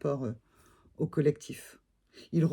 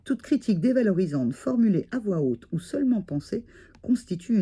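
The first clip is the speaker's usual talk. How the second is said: higher, louder formal reading of the card text; reading the card aloud; 6 semitones higher, 6 dB louder than usual. Shown as a demonstration. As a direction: declaim the card's message. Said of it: "mais pour lire".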